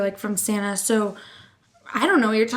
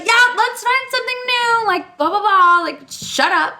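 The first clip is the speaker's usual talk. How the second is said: high, sarcastic mock cheer; fake-agreeable then dismissive, pitched up; sarcastic agreement; 10 semitones higher, 5 dB louder than usual. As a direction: blah blah in a higher mocking voice. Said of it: "I'm like".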